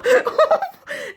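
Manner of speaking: very high, giggling laugh; real laughing fit. Real, not acted. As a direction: lose it at the bad accent. Reader laughs at own impression.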